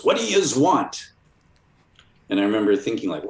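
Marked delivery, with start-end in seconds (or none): 0.0-1.1 high, slow New York "yous" growl; a recluse confronting a stranger through the chained door; Ditko at the door. Retold or imitated. Ditko answering the knock, slow and high. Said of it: "and he goes".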